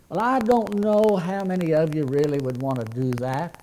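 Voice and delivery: ordinary delivery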